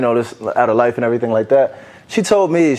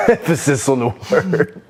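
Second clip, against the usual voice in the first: slow, higher echo through laughter; laughing; 4 semitones higher than usual. Real, not acted. Co-host echoes the host's remark, laughing. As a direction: repeat it while cracking up.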